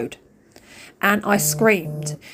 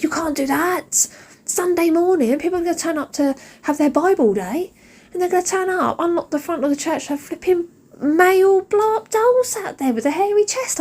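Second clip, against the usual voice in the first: scolding mum; 8 semitones higher than usual. Retold or imitated. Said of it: "And then I said".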